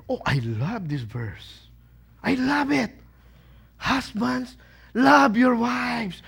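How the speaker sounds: adoring, drawn-out reading; loving it. Acted. Wife relishing the verse meant for her husband, loving it. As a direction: savour the verse like a delighted wife. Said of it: "You are the wife".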